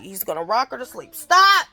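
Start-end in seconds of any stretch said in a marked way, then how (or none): 1.1-1.7 sudden loud, high command; real sharp shout. Real, not acted. Mother to kids making noise, sharp shout. Calling out to noisy kids nearby.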